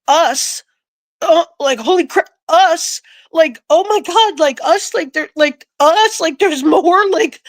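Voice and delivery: stunned, reliving the realisation of "us"; shocked disbelief. Real, not acted.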